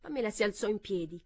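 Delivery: ordinary delivery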